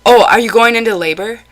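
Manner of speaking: quick, high, loud alarmed questions; mimicking him bursting in. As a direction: play the nosy relative barging in, all worked up. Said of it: "he would burst into my room and say".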